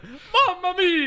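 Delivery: put-on over-the-top Italian accent, wailing mamma mia; fake Italian accent. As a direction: cry out in a cartoon Italian accent. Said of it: "the fake Italian man I've ever seen".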